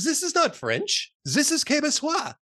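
loud, high, slow mock indignation; offended Frenchman. French boss offended at the demo, indignant and emphatic. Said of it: "suddenly gets very offended".

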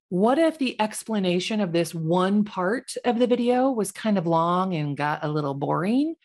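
normal talking voice

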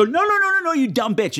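very high no's dropping into an insult; exasperated screen heckling. Acted. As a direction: scold the fool on screen in a high voice. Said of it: "point at the screen and go".

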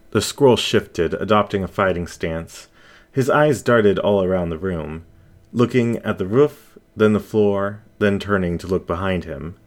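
plain reading voice

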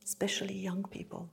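whispered aside. Real, not acted. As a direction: drop to a whisper. Unvoiced aside.

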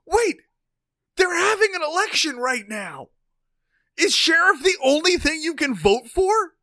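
realizing mid-chat that the ballot has only a sheriff, high and loud; sudden excited realization. Real, not acted. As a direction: blurt it out as it dawns on you. Sudden realization about no mayor.

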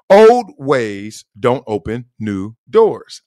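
slow, spaced-out, emphatic saying; slogan delivery. Real, not acted. Host presenting the Wileyism, slow and emphatic. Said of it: "here's the Wileyism".

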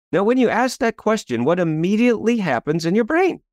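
ordinary talk

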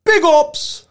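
put-on high, loud Jamaican accent. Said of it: "doing his Jamaican voice".

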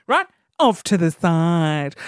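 usual speaking voice

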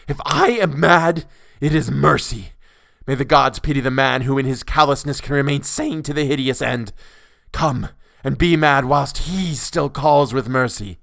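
loud, high, impassioned outburst; crying out madly. A narrator's reading. Madman answers being told he is mad, loud raving cry. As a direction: cry it out like a zealot, stretching key words. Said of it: "and cried".

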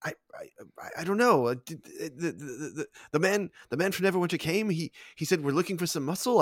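halting stammer, swinging from hushed to high; flustered suspect. Acted. Cornered character recounts being hired, nervous stammer. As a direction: stumble nervously through the explanation.

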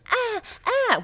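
mimicking the co-host's high-pitched moans; high mock moans. Objecting that co-host was unfair, high mock moans. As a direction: throw back two high "ah"s in imitation. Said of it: "because you just went".

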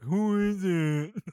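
put-on slow, high drowsy child; sleepy kid voice. Sleepy Tommy woken at the door, slow drowsy whine. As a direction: drag it out like a half-asleep kid. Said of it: "talking about, like".

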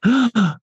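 sharp, high intake of breath; sudden panicked gasp. Retold or imitated. Actor showing how panic hits, sudden gasp. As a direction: gasp as if dread just hit.

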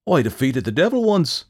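loud, high indignant protest. A narrator's reading. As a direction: protest your innocence. Jack protesting.

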